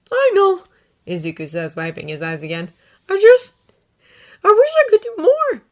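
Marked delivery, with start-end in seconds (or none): 0.1-0.6 put-on higher boy's voice, brave; putting on a brave face; young Izuku. A narrator's reading. Izuku reassuring All Might as he leaves. Boy voice. 3.1-5.6 much higher, wistful; wishing through tears; tearful boy. A narrator's reading. Izuku tearfully telling Miss Shimura.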